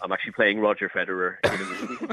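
put-on low, understated Dublin accent as Donald Gleeson. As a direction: keep up the Dublin pose. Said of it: "this individual has a Dublin accent".

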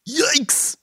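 high gasp then squeak; frightened gasp. Acted. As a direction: jump in fright. Scared by the Overtalk.

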